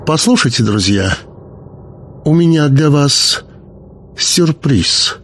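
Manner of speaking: high, strained announcement; slightly artificial, high-pitched hostess; tense, unnatural voice. A narrator's reading. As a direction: raise the pitch with a touch of forced tension. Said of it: "напряжённые нотки в её голосе".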